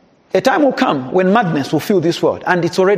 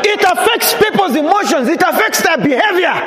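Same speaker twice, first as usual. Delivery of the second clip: higher, shouting sermon climax; fiery preaching; 6 semitones higher than usual. Acted. Pastor warning the congregation about worldly spirits, fiery preaching. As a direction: preach it at full force, calling out. Sermon rising to full force.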